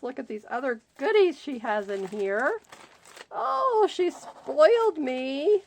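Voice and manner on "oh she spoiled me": high, loud, drawn-out delight; real squealing joy. Real, not acted. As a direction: gush over the gift. Delighted by gift unboxing.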